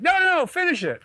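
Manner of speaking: very high pleading; mock insistence. Craig insisting, mocked very high. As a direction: push him to keep fishing. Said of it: "He's like".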